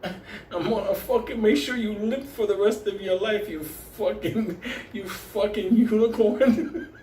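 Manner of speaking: high, laughing mock threat while twisting her leg. To the woman in a leg lock, laughing mock threat. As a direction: growl it out half-laughing, hammed up.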